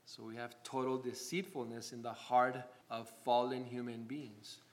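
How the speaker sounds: normal talking voice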